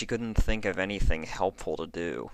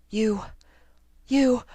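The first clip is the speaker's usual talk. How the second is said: higher, louder sputtered anger; sputtering; 7 dB louder than usual. A narrator's reading. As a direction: choke on the insult. Lyra losing coherence in rage.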